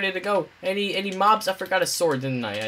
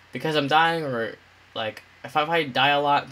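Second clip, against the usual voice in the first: ordinary delivery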